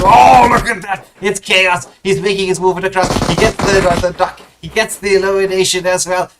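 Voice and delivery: high, loud burst of match commentary; excited play-by-play. Real, not acted. Caster reacts to Chaos making his move, excited. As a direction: erupt on the big play, then keep calling it high and loud.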